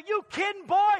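loud, high shout, slowing down on son; joyful father's shout. Acted. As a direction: roar it out, overjoyed. Father answers the returning son.

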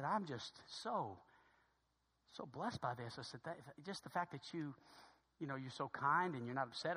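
low, soft, halting gratitude; heartfelt thanks. Retold or imitated. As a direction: retell it hushed, slowing on how blessed. Father thanks the kind stranger.

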